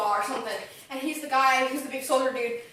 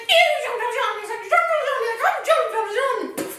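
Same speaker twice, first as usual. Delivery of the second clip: mock anguished shout; 10 semitones higher, 6 dB louder than usual. Retelling Javert's breakdown.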